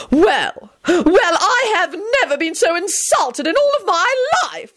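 very high, affronted lady's wail; outraged howl. A narrator's reading. Insulted Lady Fanshawe protesting, howling in outrage. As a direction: howl with hammed-up indignation, voice pitched right up. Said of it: "Lady Fanshawe howled".

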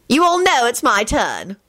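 high, slow bossy insistence; high-pitched, imperious and drawn out. A narrator's reading. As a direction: insist like a demanding mum. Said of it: "Molly announced bossily".

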